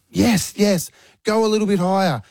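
retold cheering. Proud dad cheering daughter.

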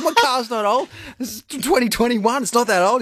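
high, indignant mock protest; fighting back. Dad retells defending his car to seven-year-old, indignant. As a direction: reenact a wounded, defensive comeback. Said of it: "I fight back".

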